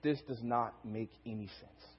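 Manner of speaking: low, slow, hushed puzzlement; a baffled mother's private thought, very soft; thinking to herself. Retold or imitated. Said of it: "she's thinking to herself".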